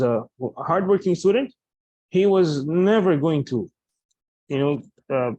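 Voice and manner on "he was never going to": slow, with a long-held "never"; emphatic negation. Shown as a demonstration. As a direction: stretch "never" to rule it out completely. Teacher models emphatic negation example.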